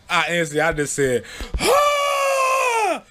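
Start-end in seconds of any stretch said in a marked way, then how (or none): 1.6-3.0 very high shrieking laugh; screaming laugh. Retold or imitated. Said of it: "You know how I be doing them laughs".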